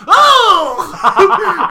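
big, high, loud mock "oh"; a whole shocked crowd in one cry; audience gasp. Host imitates the audience reacting to a kiss, loud mock shock. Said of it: "Everyone in the audience but me goes".

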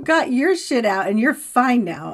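high, loud mock huff; playful indignation. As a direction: lay on the grievance, half joking. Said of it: "I'm like".

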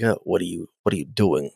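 lightly voiced gangster, low and soft; a hint of old-time gangster menace, dropped low; 1930s gangster. Picard catching her sneaking out, lightly imitated low gangster voice. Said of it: "he sounds like a nineteen thirties gangster".

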